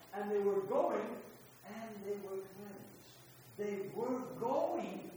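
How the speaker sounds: drawn-out, weighty reading; slow emphatic reading. Acted. Preacher savours the verse for the church, slow and weighty. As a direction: linger on every word. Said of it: "preacher savours the words slowly".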